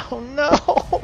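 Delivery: drawn-out, high dismayed wail; slow cry of dismay, sliding up high. Real, not acted. Player breaks off chat as somebody's dead, dismayed. Character dies mid-chat.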